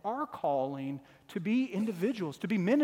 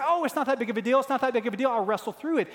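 lightly voiced young wrestler, higher and louder, brushing it off; dismissive young athlete; 6 semitones higher, 5 dB louder, much faster than usual. Young wrestler insisting to the trainer he can go on, lightly voiced. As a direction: shrug it off like a hurt kid eager to keep going.

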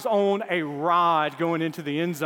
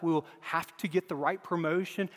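normal talking voice

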